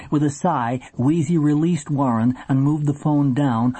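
ordinary delivery

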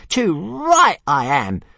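high, slow grumbling dragon voice; grumpy little dragon. A narrator's reading. Small dragon grumbling at Hiccup, high and slow. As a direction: sulk it out as a tiny proud dragon. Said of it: "said Toothless grumpily".

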